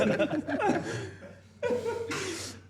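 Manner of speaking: very high-pitched real laughing fit; can't stop laughing; helpless laugh. Real, not acted.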